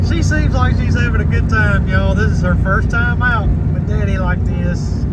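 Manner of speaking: high doting voice, easing down a little. Real, not acted. Daddy tells viewers about baby's first trip, doting high voice.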